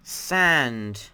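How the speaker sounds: loud one-word title; announcer call. Real, not acted. Host naming the next dimension for viewers, loud announcement. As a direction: call out the name, long and loud.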